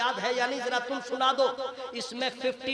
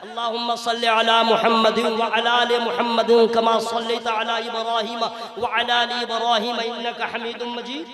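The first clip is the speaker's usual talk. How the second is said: recited prayer; 2 semitones lower, 5 dB louder than usual. Shown as a demonstration. Preacher reciting the durood for the congregation, devout recitation. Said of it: "अब वो दुरूद कौन है ना".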